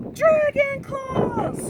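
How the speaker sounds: high, slow drawn-out cry; spooky dragon call. A narrator's reading. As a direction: wail it like a ghostly warning. Brothers fake a dragon alarm.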